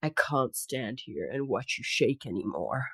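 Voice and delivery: soft Australian leading man; quiet Aussie hero, composed; Aussie accent. Acted. Snow monster to shivering heroine, soft Aussie accent. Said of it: "stick to your Aussie accent".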